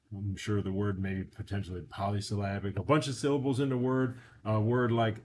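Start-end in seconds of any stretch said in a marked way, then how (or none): none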